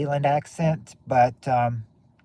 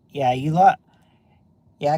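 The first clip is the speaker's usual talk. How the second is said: ordinary talk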